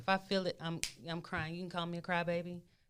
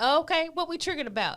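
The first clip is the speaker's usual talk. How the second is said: high and loud, ready to dig in; brisk upbeat self-talk; 10 semitones higher, 8 dB louder, faster than usual. Pretend. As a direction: greet the trigger like a challenge.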